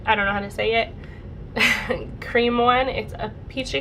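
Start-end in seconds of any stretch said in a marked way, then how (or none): none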